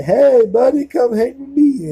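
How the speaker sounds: high, loud goofy buddy call; cable guy voice. Retold or imitated. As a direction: call out like an overeager weirdo. Cable guy calls from van.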